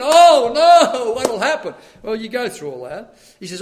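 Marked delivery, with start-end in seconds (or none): 0.0-1.7 high, loud mock panic; mock fearful. Preacher acts out fretting over half the church leaving, mock fearful. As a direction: wail it in exaggerated dread. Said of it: "it can be a little fearful".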